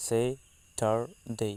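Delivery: স্যাটারডে spoken very slowly with a soft fall; careful model pronunciation. Shown as a demonstration. Teacher repeating স্যাটারডে slowly for students to copy. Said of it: "এটার উচ্চারণটা হবে এমন".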